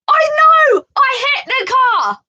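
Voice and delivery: high, loud panicked cry; panicked shout. Retold or imitated. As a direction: yell it back in a panic. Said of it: "making me panic".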